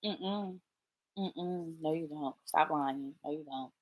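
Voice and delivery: low, soft muttered contradiction; hushed, knowing disagreement; quiet dismissal. Real, not acted. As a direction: mutter it under your breath, unconvinced. Muttered rebuttal to imagined parents.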